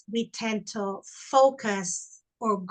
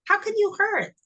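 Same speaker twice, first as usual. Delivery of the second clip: emotional disbelief; 8 semitones higher than usual. Real, not acted. Mother remembering her small daughter's grief, voice high with emotion. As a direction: pitch rising high with real emotion, voice near breaking. Mother recalls grieving three-year-old.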